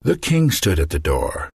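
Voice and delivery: ordinary delivery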